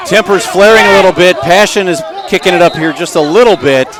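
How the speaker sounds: building excited play-by-play. Real, not acted. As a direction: call the run as it builds. Live play-by-play rising.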